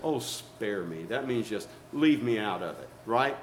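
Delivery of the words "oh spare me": low, soft, drawn-out sarcasm; sarcastic. Shown as a demonstration. Telling somebody to leave you out, sarcastic. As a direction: brush them off, slow and dry. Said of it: "You tell somebody sarcastic".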